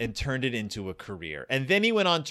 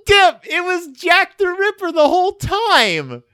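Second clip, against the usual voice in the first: put-on much higher, slow childlike gasp of awe; impressed nine-year-old; 8 dB louder, slower than usual. A nine-year-old reacts to the twist ending, high and awed. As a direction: play a kid wowed by a twist. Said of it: "a nine-year-old".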